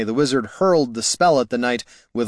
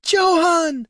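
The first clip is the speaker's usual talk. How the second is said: high, joyful princess cry; delighted shriek; 4 dB louder than usual. A narrator's reading. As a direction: squeal the hero's name in delight. Princess cries out to her rescuer.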